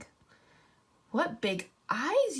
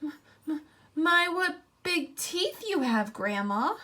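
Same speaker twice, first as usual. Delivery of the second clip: stuttering fright; 6 semitones higher, 3 dB louder than usual. Acted. Girl stammering at the wolf in bed, frightened. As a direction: stammer it out, scared of the teeth.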